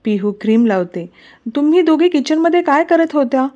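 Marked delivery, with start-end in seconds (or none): 1.6-3.5 high-pitched scolding without much volume; scolding yell. Acted. Pihu demands to know what they did in the kitchen, scolding. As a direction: raise the pitch in sharp reproach. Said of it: "पिहू ओरडून बोलते".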